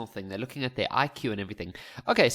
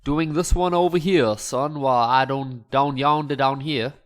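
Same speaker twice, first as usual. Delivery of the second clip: louder, hammed-up Southern American accent; 6 dB louder than usual. Maths teacher jokingly slips into a hammed-up Southern American accent. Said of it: "I went slightly American over there".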